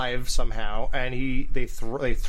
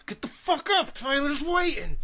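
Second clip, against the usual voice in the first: mad-dog order; 11 semitones higher than usual. Retold or imitated. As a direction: imitate an unhinged man ordering someone up, voice pitched high. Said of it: "he looks like a mad dog".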